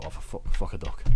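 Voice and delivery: half-laughed, breathy fuck a duck; laughing exclamation. Shown as a demonstration. Showing the funny phrase he uses to break a loop, laughing. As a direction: giggle the silly phrase out. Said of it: "I just go".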